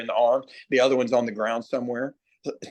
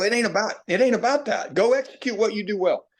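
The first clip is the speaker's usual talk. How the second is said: higher, insistent pep talk to a hitter; emphatic retort; 8 semitones higher than usual. Retold or imitated. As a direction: brush off his complaint and push him. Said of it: "I go".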